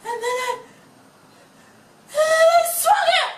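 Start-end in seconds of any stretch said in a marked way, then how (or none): 2.1-3.3 high anguished outburst; anguished cry. Acted. Climax of retelling.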